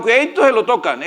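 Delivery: ordinary delivery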